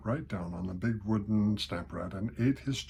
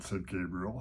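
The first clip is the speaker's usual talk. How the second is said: usual speaking voice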